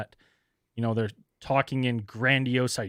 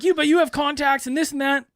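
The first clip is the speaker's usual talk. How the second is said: mock caller; 11 semitones higher, 5 dB louder than usual. Voicing the caller.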